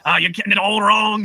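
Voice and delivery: a cranky old Irishman scolding a church about their chart, hammed-up Irish accent; curmudgeonly old Irishman. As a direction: grumble it like a crotchety old Irish preacher, in a bad Irish accent. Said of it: "be like this curmudgeonly old Irish guy".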